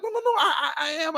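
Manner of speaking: a panicked squeaky denial; flustered protest. Retold or imitated. Insisting to the colleague that he is serious, high and flustered.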